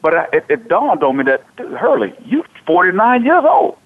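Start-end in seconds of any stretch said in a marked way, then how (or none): none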